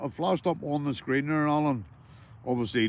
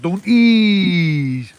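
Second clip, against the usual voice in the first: loud demanding shout; 5 semitones higher, 14 dB louder than usual. Real, not acted. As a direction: bellow "don't yous" slowly and loudly at the viewers. Shouting at chat to agree.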